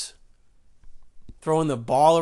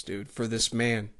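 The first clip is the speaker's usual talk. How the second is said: ordinary talk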